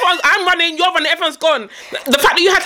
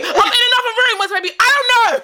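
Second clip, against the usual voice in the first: mock frantic mom; 10 semitones higher than usual. Acted frantic dialogue, high pitch.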